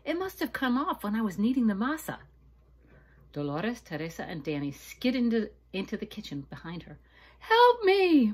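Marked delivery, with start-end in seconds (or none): 0.0-2.2 raised, louder voice, alarmed; worried realization. Acted. Maria realizing the ring is lost, alarmed. 7.4-8.3 high, loud desperate cry; cry for help. Acted. Maria calling her cousins into the kitchen, loud cry. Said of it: "Maria cried".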